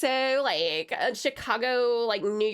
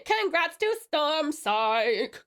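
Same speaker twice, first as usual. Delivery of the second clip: very slow, stretched-out cheer; slow, showy congratulations; drawn-out congratulations; much slower than usual. Pretend. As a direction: stretch every word of the congratulations. Celebrating winner, first take.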